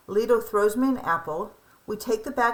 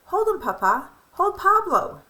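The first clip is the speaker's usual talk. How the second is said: tender offering; 10 semitones higher, 5 dB louder than usual. Retold or imitated. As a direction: hand him the baby with love. Mother hands baby to grandfather.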